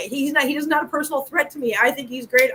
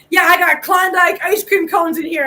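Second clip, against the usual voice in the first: retold call across the street; 4 semitones higher than usual. Homeless man calling across street.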